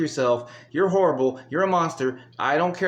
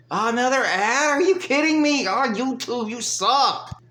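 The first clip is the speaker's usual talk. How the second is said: high-pitched, louder cry of annoyance at the ad; exasperated outburst; 9 semitones higher, 3 dB louder, slower than usual. Real, not acted. Streamer hit by yet another ad, yelling in exasperated disbelief. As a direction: blow up at YouTube in disbelief.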